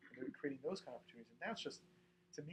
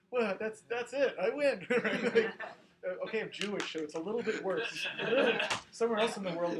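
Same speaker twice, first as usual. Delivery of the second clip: laughing shout; 7 semitones higher, 14 dB louder than usual. Real, not acted. Mocking his own privilege, laughing.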